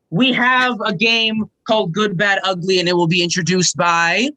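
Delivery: ordinary talk, nothing marked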